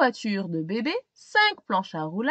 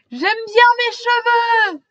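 high, loud cheerful dad; dad's voice; 12 semitones higher, 9 dB louder than usual. Acted. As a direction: proudly declare love of his hair.

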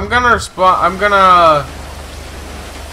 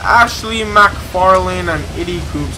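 ordinary talk, nothing marked

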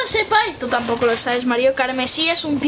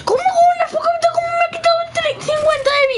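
high, fast furious yelling; angry disbelief shout; 13 semitones higher, faster than usual. Real, not acted. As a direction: explode at the unfair hit. Indignación por perder vida. (grito indignado y agudo)

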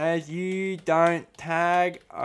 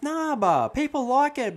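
much higher, scoffing brush-off; high dismissive whine; 10 semitones higher than usual. Acted. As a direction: wave the advice away. Dismissing advice, pitch jumps.

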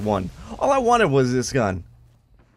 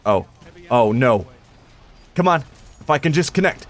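normal talking voice

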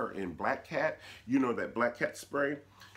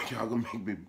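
ordinary delivery

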